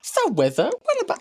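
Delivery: high lisping interviewer puppet; Tiny Chef. Retold or imitated. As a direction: lisp a question to the guest. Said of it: "And she was like".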